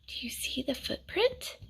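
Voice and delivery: hushed whispered question. Real, not acted. Reading to a child, asking in a hushed whisper. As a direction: whisper it like sharing a secret.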